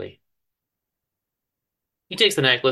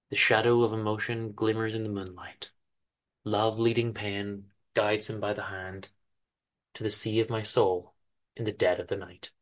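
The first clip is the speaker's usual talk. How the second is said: quiet poem recital; 2 semitones lower, 6 dB quieter than usual. Acted. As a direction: savour each line quietly. Recites lyrics again.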